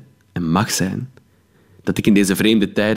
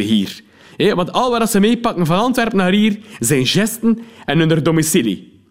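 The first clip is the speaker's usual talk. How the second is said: chatty cop; 8 semitones higher than usual. Acted. Policeman praises the narrator and mocks the incomers, chummy. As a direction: rib the incomers for her to hear. Cop talks, woman overhears.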